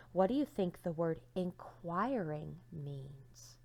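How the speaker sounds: ordinary delivery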